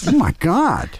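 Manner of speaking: high-pitched astonished reaction; playful amazement. Pretend. Cohost hearing the offer for the land, playful amazement. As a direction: act bowled over by the offer.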